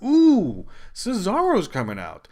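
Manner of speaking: the fan sees a favourite arrive; thrilled ooh. Retold or imitated. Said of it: "And we're like".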